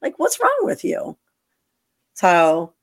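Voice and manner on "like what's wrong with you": high, incredulous outburst; exasperated. Retold or imitated. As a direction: throw up your hands.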